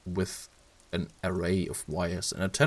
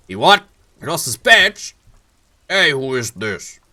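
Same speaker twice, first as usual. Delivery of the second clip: much higher and louder, outraged disbelief; incredulous shout; 6 semitones higher, 10 dB louder than usual. Acted. Captain shouting in disbelief at the lost badge. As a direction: bellow it in disbelief across the room.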